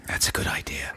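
soft conspiratorial whisper. Real, not acted. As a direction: whisper it like a secret plan. Whispered agreement.

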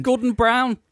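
mock outraged. Interjects spoof phone-in topic.

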